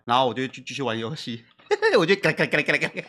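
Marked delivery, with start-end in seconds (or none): 2.0-3.0 laughing, mouth-made clacking keys; keyboard clatter. Retold or imitated. Husband laughs while mimicking his keyboard clatter. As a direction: rattle off the keys through a laugh.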